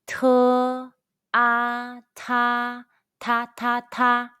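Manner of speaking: "Te, a, ta" high and level, then "ta" repeated shorter; high-pitched first tone. Shown as a demonstration. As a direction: drill "ta" on one high note for repetition. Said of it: "the high-pitched first tone all together".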